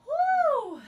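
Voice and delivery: loud high whoop of relief. Real, not acted. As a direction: cheer as the set ends. Celebrating finishing the ab set.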